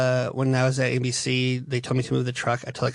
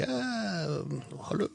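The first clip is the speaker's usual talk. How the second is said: put-on high, soft Russian-girl voice; mock Russian accent; 6 semitones higher, 7 dB quieter, slower than usual. Reviewer imitates Bond's Russian woman, Russian-accented coo. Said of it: "the Russian girl's hot".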